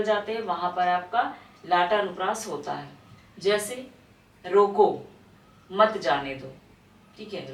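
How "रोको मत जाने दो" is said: रोको alone, long pause, then मत जाने दो together; pause after रोको. Shown as a demonstration. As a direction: break right after रोको, keeping मत with जाने दो. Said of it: "आगे पीछे आपका विराम का प्रयोग". (रोको के बाद विराम)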